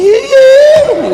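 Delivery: high cheering yell; joyful shout. Retold or imitated. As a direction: cheer out loud with relief. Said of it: "Kalau sone mama mati".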